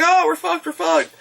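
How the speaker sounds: high-pitched mock dismay; hammed-up doomed crowd; mock panic. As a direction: panic in a high voice, half joking. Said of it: "So then they're like".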